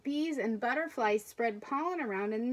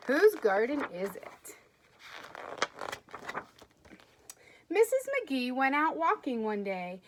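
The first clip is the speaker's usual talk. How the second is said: lively storybook read-aloud; expressive picture-book narration; reading to children; 3 semitones higher, 4 dB louder than usual. Acted. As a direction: read it as if to a class of kids.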